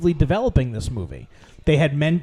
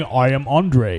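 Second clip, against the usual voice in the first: deep Vin Diesel giant voice; 4 semitones lower, slower than usual. Retold or imitated. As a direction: lower slow giant impression. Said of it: "C G I Andre voiced by Vin Diesel".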